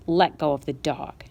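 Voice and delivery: low, slow, calm firm order; said calmly. Acted. Mother calmly ordering her son to let go of the dog. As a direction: calmly lay down the law. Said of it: "she said calmly".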